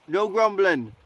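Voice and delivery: ordinary talk, nothing marked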